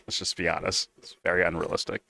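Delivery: ordinary talk, nothing marked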